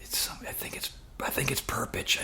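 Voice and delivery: hushed whispered aside; acted covert whisper to a roommate; hand over receiver. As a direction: whisper so the caller can't hear. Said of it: "put his hand over the receiver".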